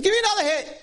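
a hint of a tipsy guy calling for a drink; lightly voiced high call for another shot; tipsy order. Boy at the bar asks for another shot, tipsy and high.

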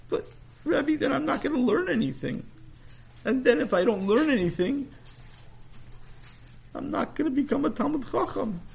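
put-on high, upset little boy; a kid confessing, pitched high; young boy voice. Sammy voiced by narrator.

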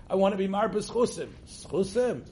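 "chusim" said high, as a learner would say it; imitating American pronunciation. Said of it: "like you'll say".

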